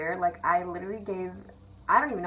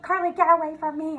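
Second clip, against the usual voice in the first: high, louder teacher's rebuff; mock a fed-up teacher shooing a student off; exasperated retold scolding, pitched up; imitated annoyed teacher; 8 semitones higher, 5 dB louder than usual.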